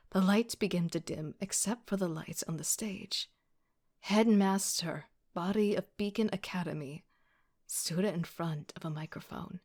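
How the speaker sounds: plain reading voice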